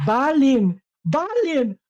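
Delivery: high, excited exclamation; real surprise, voice jumping up; surprised recognition. Real, not acted. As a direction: blurt the name in delighted disbelief. Player recognizes name from Hobbit.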